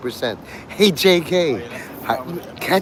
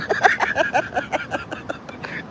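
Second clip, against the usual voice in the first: high airy laugh; 13 semitones higher, 5 dB quieter than usual. Real, not acted. Laugh after Batman bit.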